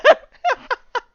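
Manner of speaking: loud, high-pitched laughing fit; helpless laughter. Real, not acted. Cracking up at the comic's absurd plot, helpless laughter. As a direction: lose it completely, laughing. Breaks down laughing at own story.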